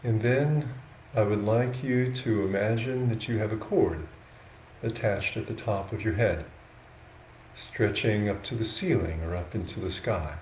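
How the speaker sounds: slow, unhurried guidance with long pauses between phrases; guided meditation. Real, not acted. Monk guiding yogis into an upright sitting posture, slow meditation voice. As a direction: talk them gently into posture, a little softly, leaving space.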